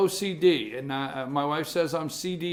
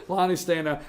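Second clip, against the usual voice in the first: normal talking voice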